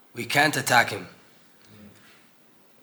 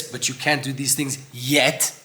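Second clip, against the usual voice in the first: "yet" leaned on, slow and drawn out; stress on yet; slower than usual. Shown as a demonstration. Teaching listeners that change is still possible, slow stress on "yet". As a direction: hold and weigh the word "yet". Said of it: "emphasis on the word yet".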